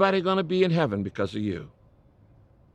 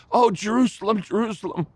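higher, swelling call fading to a hush; passionate cry replayed; 7 semitones higher than usual. Shown as a demonstration. Preacher replays Jesus' lament for the congregation, passionate then hushed. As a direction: replay the anguished cry, letting it die away. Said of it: "Can you hear the verse that the passion had".

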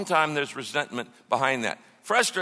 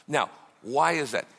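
ordinary talk, nothing marked

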